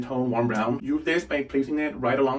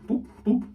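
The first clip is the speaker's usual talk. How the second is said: playful boops; 11 semitones higher than usual. Real, not acted. Playful sound while placing lashes.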